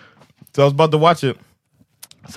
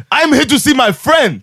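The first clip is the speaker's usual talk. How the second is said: insistent; 10 semitones higher, 4 dB louder than usual. Retold or imitated. Retold passenger insists to inspector.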